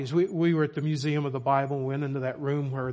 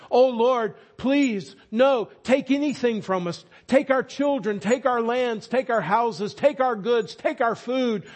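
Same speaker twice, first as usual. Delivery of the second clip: higher, louder desperate begging; pleading with God; 6 semitones higher, 4 dB louder than usual. Retold or imitated. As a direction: beg the Lord not to take his word. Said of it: "began to play the part of the congregation, pleading with the Lord".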